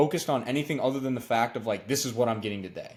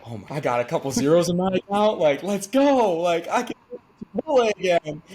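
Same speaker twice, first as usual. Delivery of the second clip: excited; 7 semitones higher, 5 dB louder than usual. Retold or imitated. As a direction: burst with joy at the paycheck, pitched way up. Seeing first paycheck at midnight.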